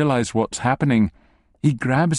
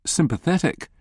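plain reading voice

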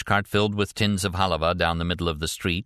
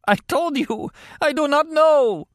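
very high, anguished protest; desperate denial; 5 dB louder than usual. A narrator's reading. Wounded captive insisting to interrogator, desperate. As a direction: cry it out, dragging the last words.

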